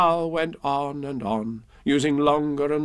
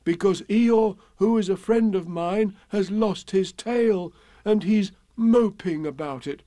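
higher, plaintive bear voice; earnest Pooh bear; 8 semitones higher than usual. Acted. Pooh asking Owl for help, earnest and plaintive. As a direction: plead earnestly, pitched up. Pooh's character voice.

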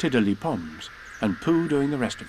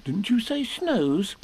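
light Piglet voice; 9 semitones higher, slower than usual. Acted.

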